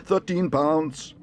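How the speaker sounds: high, loud, drawn-out bid; calling a bid. A narrator's reading. Mister Gruber raising his bid, calling out. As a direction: call it slowly across the hall.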